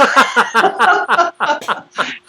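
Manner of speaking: high chuckling fit; helpless, rolling giggle; laughter. Real, not acted. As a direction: laugh at your own punchline. Repeats own punchline.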